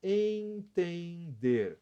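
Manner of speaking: drawn out syllable by syllable, very slow; slow syllable demo. Shown as a demonstration. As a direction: spell Entender out slowly by syllables. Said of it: "São três sílabas".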